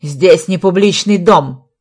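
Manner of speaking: loud, slow reprimand; angry shouting. A narrator's reading. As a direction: shout it out word by word. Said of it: "закричал капитан милиции". (громко, с расстановкой кричит)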